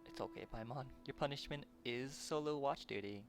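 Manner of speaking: ordinary talk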